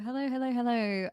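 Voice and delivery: normal talking voice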